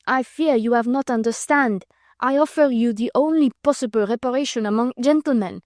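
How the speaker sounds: put-on earnest broken English with a light French accent. Vicomte insisting on his challenge, earnest French accent. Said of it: "in still more broken English".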